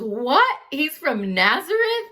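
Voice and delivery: high, loud disbelieving question; can't believe it, pitch jumping up; incredulous. Retold or imitated. Nathanael scoffing at Philip's news, incredulous. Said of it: "And he goes".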